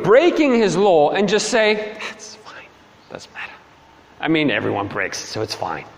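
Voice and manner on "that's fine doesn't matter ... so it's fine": breathy, hushed shrug-off; dismissive hush. Pretend. A lax judge waving off sins, hushed. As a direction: brush it away in a hush.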